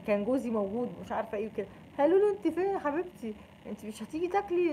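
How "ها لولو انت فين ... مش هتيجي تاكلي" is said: higher, sugary coaxing voice; mock sweet call. Phoning the late friend at iftar, sugary coaxing. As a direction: coax her like a darling.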